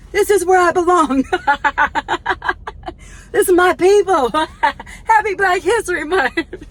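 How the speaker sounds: high, loud, laughing joy; excited. Real, not acted. Auntie celebrating the cheer battle, excited and laughing. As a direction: gush with delight between laughs.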